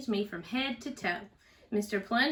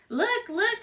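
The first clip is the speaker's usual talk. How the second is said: high, bright little chirp; chirpy; 12 semitones higher, 4 dB louder than usual. A narrator's reading. Mother reading to kids, chirping Miss Bonker's line. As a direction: chirp it like an excited teacher. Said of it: "she chirps".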